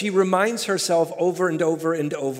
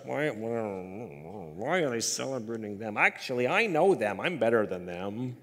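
inner grumbling; 4 semitones lower than usual. Acted. Little heart jealous of someone celebrated, low mock grumbling. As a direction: grumble it under your voice like a petty heart. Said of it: "It just grumbles in here".

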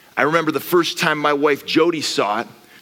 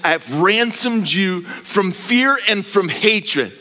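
slow, building to a loud high proclamation; bishop's blessing; 8 semitones higher, 4 dB louder, slower than usual. Acted. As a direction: bless him slowly, rising to a shout. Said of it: "He says".

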